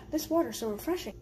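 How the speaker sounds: usual speaking voice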